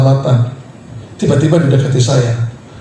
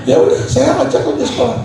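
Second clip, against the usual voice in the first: usual speaking voice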